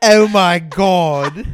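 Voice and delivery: loud, high, very drawn out; slow laughing exclamation. Real, not acted. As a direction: wail it through laughter. Cracking up at the caricature.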